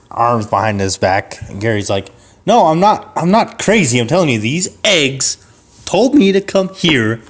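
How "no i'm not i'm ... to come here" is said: insistent protest. Acted. Gary insists to the guard he's not crazy, pitched up. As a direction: plead your sanity, pitched up.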